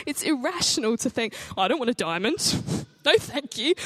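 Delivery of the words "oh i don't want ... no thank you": a lightly voiced prim refusal with a laugh; hammed-up polite refusal; mock prissy refusal. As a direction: play a lightly mocking dainty no-thank-you.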